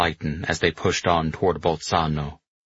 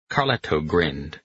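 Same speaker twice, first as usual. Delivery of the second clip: usual speaking voice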